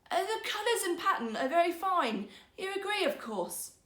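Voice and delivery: a cheat laying it on thick; sly rogue. Acted. Rogue weaver pressing the minister to agree, smug.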